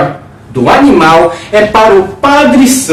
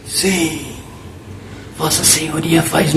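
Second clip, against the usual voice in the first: said softly; 4 semitones lower, 12 dB quieter than usual. A narrator's reading. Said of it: "O adjutor disse baixinho".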